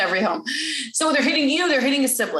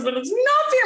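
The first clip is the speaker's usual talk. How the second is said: high-pitched mock child's wail; crying child imitation; 14 semitones higher than usual. Child complains to the parent, high mock-crying voice. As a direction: put on an upset child's protest. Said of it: "starts crying and says".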